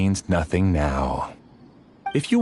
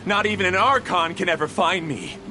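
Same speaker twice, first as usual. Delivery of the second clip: defiant villain rant; 9 semitones higher than usual. Acted. Defeated villain's defiant declaration.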